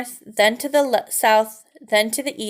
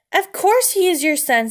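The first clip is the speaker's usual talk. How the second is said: indignant retort; 7 semitones higher, 4 dB louder than usual. Acted. Mother mouse retorts to husband.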